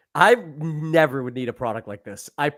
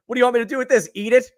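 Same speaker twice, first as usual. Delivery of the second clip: incredulous; 7 semitones higher, 6 dB louder, much faster than usual. Retold or imitated. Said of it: "I looked at it like".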